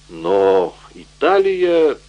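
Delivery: Тревье burrs the r in Италия; Италия with a guttural French r; says w for r. A narrator's reading. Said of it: "прокартавил доктор Тревье".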